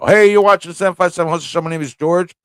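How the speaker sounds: brisk, fast host intro; show intro. Acted.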